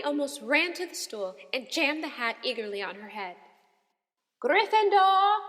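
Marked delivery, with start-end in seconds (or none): 4.4-5.4 slow, high, loud booming hat; hat shouting. A narrator's reading. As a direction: bellow the house like a magic hat. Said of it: "shouted the hat".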